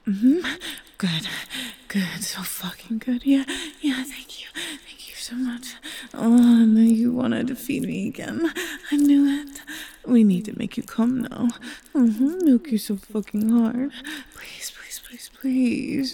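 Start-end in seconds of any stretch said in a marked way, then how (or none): 2.2-5.7 very soft unvoiced whisper. Lover thanking her partner, breathless whisper. 14.3-16.1 soft unvoiced begging; whispered pleading. Lover begging her partner, hushed whisper.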